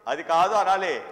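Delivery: ordinary talk, nothing marked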